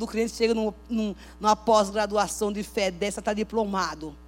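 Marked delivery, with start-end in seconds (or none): none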